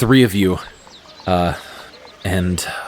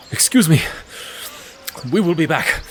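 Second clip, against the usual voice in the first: higher, hurried-off guard voice; urgent guard; 8 semitones higher than usual. Acted. Guard to villagers before rushing to the keep, urgent. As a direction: excuse yourself urgently, pitched up.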